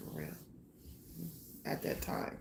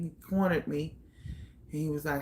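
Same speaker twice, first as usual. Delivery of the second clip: usual speaking voice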